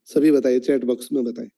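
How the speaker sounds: normal talking voice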